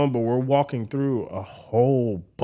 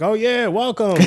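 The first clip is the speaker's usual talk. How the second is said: higher-pitched, gushing greeting; excited welcome; 9 semitones higher than usual. Retold or imitated. Festival manager greets the arriving band, excited welcome. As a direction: play an eager host welcoming the act.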